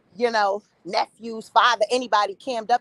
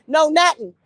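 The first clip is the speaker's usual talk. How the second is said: ordinary talk, nothing marked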